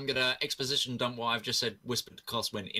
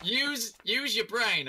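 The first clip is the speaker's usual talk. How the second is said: high teasing; 10 semitones higher, much slower than usual. Real, not acted. Laughing teasing player.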